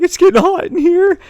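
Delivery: very high, loud imitated British panic; mock panic. Girl locked in a locker, mock panicking British voice. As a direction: squeal it in pretend alarm. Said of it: "starts panicking".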